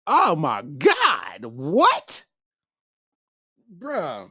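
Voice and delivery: ordinary delivery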